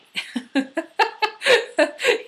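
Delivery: loud high laugh; a burst of high laughter; high cackling laugh. Real, not acted. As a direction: cackle at the gag. Laughs at own skit.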